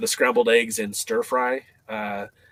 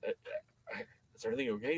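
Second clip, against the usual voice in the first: much softer, rushed question; worried aside; 13 dB quieter, much faster than usual. Retold or imitated. Dave checking quietly on his friend, soft and fast. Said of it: "He goes".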